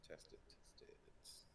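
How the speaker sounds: unvoiced, slow whispered mic check; whispering. Real, not acted. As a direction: breathe it out for testing. Mic test.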